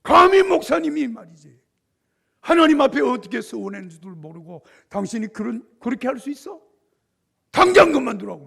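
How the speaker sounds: high, loud furious tirade; wife berating the general over the pastor; wife's angry scolding. Retold or imitated. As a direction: tear into him in front of guests. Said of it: "사모님이 화를 막 소리를 지르니까".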